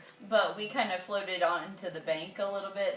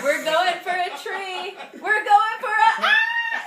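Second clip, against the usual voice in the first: high, loud laughing cries building to a scream; hammed-up panic breaking into a squeal; mock panic; 12 semitones higher, 9 dB louder than usual. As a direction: reenact rising alarm, climbing to a shriek. Reenacts the panic before crashing.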